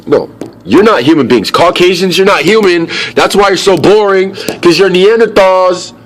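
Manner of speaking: loud, high-pitched tirade; worked-up, forceful outburst; heated rant. Real, not acted. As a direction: raise pitch and volume in an angry rant. Heated rant at an imagined group.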